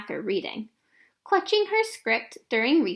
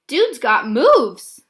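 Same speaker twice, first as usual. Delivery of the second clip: impressed thought; 9 dB louder, much slower than usual. Acted. Girl impressed by Bayo's tricks.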